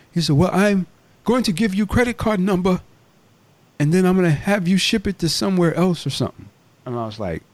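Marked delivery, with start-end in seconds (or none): 0.4-6.5 high-pitched mock African accent. Scammer offering a card number to shop owner, African accent. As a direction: imitate an African caller, voice raised in pitch. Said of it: "like, kinda African or something".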